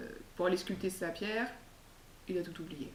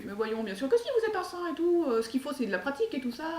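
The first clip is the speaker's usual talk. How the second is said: put-on girl reassuring; 7 semitones higher than usual. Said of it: "Lurette, elle fait".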